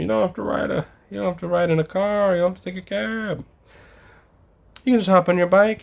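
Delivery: high, sing-song gushing praise; mocking Japan fans. Sneering at people who praise Japan, high gushing parody. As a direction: parody tourists raving about Japan. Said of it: "they always praise Japan about".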